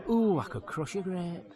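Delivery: lightly hammed-up "ooh" gag line; comic catchphrase. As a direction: blurt a silly line after a stuck build-up. Re-enacts his catchphrase on stage.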